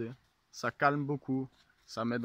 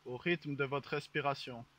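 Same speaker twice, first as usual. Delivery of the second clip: guided meditation; 5 dB quieter than usual. Acted. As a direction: lull them into calm. Leads a mock guided meditation.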